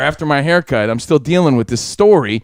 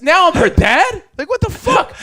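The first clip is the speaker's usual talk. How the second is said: indignant shriek, much higher; outraged yell; 13 semitones higher than usual. Real, not acted. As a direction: pitch shoots up in disbelief. Outraged at being called dad.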